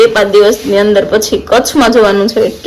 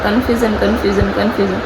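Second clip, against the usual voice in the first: softer and softer confusion; playful trailing off, each confusion softer; fading repeat; 3 semitones lower, 6 dB quieter, faster than usual. Real, not acted. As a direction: let confusion trail off quietly.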